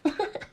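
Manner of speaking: high-pitched character laugh; acted laugh. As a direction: burst out in a staged, mocking laugh. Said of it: "As Raditz begins to laugh".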